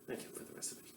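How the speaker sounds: unvoiced whispered aside, still in Russian accent; whispered in mock Russian accent. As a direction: breathe it like a secret thank-you. Aside thanking Duncan Hines.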